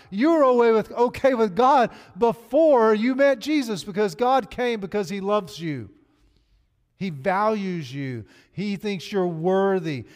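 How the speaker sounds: loud, high, fervent preaching; preaching at full force. Real, not acted. Pastor preaching to the congregation about God's love, fervent and loud. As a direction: drive it home to the back row.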